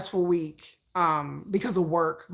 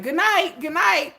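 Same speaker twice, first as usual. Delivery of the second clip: higher, lightly hammed-up farewell; sing-song goodbye to the world, half joking; mock goodnight; 9 semitones higher, 4 dB louder than usual. Giving up on the day under a blanket, mock goodnight. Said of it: "you just be like".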